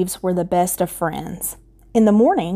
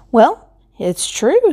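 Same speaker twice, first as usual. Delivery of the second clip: cheerful scarecrow voice; 6 semitones higher, slower than usual. Acted.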